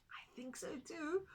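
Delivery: hushed aside to listeners; whisper. A narrator's reading. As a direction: whisper it to the children. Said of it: "whispered aside".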